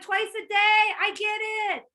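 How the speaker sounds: high, loud and thrilled; light up at the easy money; delighted yes. Retold or imitated. Blue recruit hearing about daily pay, loud and thrilled. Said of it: "They're like".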